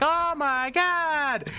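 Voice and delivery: very high, slow, drawn-out exclamation; mock shock. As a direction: stretch it out in hammed-up disbelief. Acts out player's shocked reaction.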